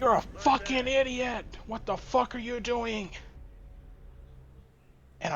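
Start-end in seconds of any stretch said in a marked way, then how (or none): 0.0-3.1 high-pitched furious insult; angry customer. Retold or imitated. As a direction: spit it out as the raging customer. Angry customer yelling retold.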